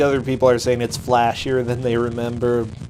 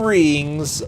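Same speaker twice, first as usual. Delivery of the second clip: normal talking voice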